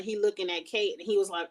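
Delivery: ordinary talk, nothing marked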